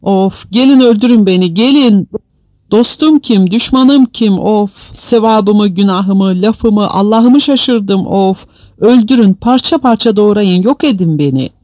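high, loud anguished begging; wailing, desperate outcry; yelled rant. A narrator's reading. Yunus keeps begging the others to kill him, yelling. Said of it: "Yunus bağırtılı konuşmasını sürdürürken".

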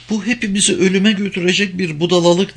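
high, quick protest from a woman; high-pitched, insistent female voice; emphatic objection. A narrator's reading. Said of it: "üstüne basa basa".

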